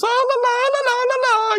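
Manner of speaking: very high babbling la-la-la; imitated cartoon laughing. Podcaster imitates Pops shaking the doll, high manic la-la laugh. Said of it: "and him laughing".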